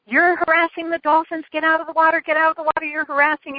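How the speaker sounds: mock-scold like an irate woman in a kayak; retold yelling. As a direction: replay the furious kayaker at a milder shout. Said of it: "screaming and yelling at me and cursing me".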